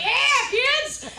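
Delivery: high, loud mock cheer; imitated gleeful shout; hollering back. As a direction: call back as an oblivious dad. Dad cheerfully calling back.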